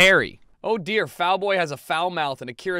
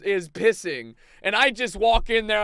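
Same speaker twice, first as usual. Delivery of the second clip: ordinary delivery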